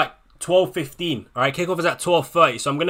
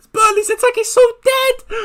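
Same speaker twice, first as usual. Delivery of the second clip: much higher, louder squeaky voice; high exasperated squeal; 5 dB louder than usual. Real, not acted. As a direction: squeal it in disbelief. Exasperated mock outburst about Burnley.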